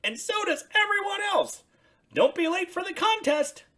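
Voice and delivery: high, loud excited Big Bird; Big Bird praising the painting. Acted. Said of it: "said Big Bird".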